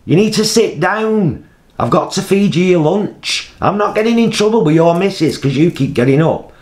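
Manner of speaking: high, loud matey holler; shouting over. Retold or imitated. Carer shouts over to wandering man, high bantering holler. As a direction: jovially scold across the room, re-enacted. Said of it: "So I shouts over".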